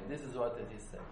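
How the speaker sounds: ordinary talk, nothing marked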